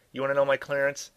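loud, high exasperated challenge; annoyed. A narrator's reading. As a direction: throw the question back, fed up. Annoyed officer challenged by the guard.

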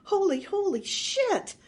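loud, high cry of shock; stunned, escalating shout; horrified yell. A narrator's reading. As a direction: let it burst out aghast. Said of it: "yelled the burglar".

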